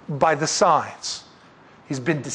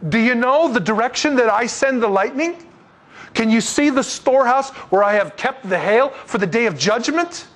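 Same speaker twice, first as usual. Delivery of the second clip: preaching God's questions; 6 semitones higher than usual. Acted.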